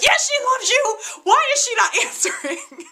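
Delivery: high, loud yell half laughing, half crying; screaming at the TV. Retold or imitated. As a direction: shout at the screen in frantic disbelief. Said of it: "I was seriously screaming at the T V".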